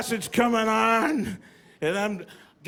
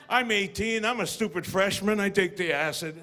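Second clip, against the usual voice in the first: normal talking voice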